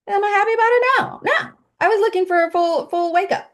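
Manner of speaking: high, loud whiny complaint; mock whiny pout. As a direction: whine like a let-down child. Mock disappointed self-questioning.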